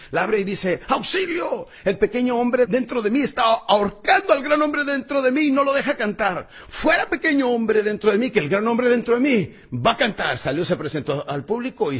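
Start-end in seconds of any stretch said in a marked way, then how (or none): 0.9-10.4 agudo y fuerte, dramatizado; grito de auxilio actuado. Acted. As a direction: pide socorro a voces, con valor. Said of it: "abre la puerta él con mucho valor". (grito teatral de auxilio)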